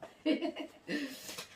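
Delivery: high breathy giggle; real laugh. Real, not acted. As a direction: giggle at your own weakness. Laughs at her own weakness. (rire aigu)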